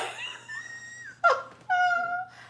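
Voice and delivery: long, high cackling laugh; real helpless laughter. Real, not acted. Host plotting to fool him with bags, cackling. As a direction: lose it laughing. Laughing at her bag plan.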